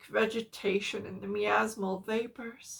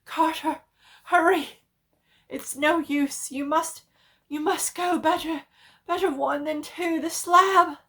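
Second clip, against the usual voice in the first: tense pleading; 6 semitones higher, 10 dB louder than usual. A narrator's reading. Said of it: "his next whisper".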